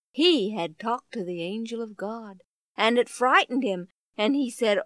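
ordinary delivery

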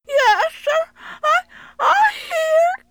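put-on high, slow, tearful little boy; scared child voice. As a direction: answer frightened from the well. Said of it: "came the muffled reply".

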